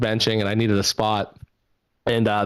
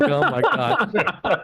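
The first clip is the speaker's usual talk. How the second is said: slow, high, laughing oh my god; laughing dread; 9 semitones higher, much slower than usual. Retold or imitated. Pinned under the bench by the chatty stranger, slow laughing disbelief.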